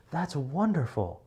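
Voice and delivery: exaggerated delight; overjoyed. Shown as a demonstration. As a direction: gush with happiness for them. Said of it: "more than happy when you express it".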